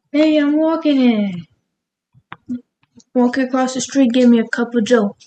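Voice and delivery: hammed-up New York street talk; New York accent. Shown as a demonstration. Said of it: "saying you can have for New Yorkers".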